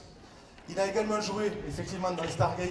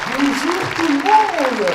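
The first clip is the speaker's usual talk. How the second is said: drawn-out shouted hello to a crowd; loud greeting; 10 semitones higher, 18 dB louder, much slower than usual. Real, not acted. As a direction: call out big to the whole hall.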